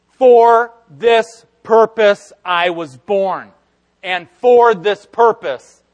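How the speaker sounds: slow, loud and solemn; solemn declaration. Retold or imitated. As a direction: proclaim it slowly.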